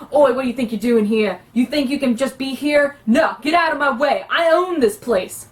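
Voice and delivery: pushy thug with a wandering put-on accent; Buduk, abrasive half-orc thug. Shown as a demonstration. Half-orc thug ordering someone out of his bar, abrasive. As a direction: claim the place like an abrasive bully. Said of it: "let me demonstrate for you the difference between Buduk".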